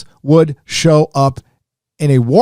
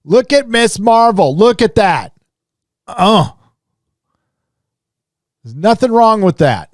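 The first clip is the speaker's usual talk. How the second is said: sarcastic exclamation; 9 semitones higher than usual. Acted. Sarcastic showcase of bad art.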